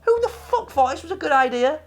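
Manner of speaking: ordinary talk, nothing marked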